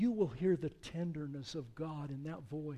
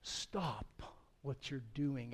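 quiet urgent call; 2 semitones lower, 6 dB quieter, slower than usual. Acted. God calls out to Abraham.

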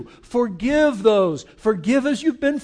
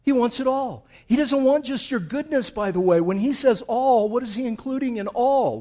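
usual speaking voice

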